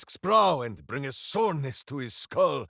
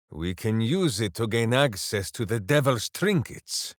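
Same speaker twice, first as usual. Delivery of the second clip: normal talking voice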